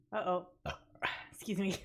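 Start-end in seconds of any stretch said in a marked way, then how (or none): 1.3-1.8 burping the words. Real, not acted. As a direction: excuse me said through a burp. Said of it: "I need to get a burp counter".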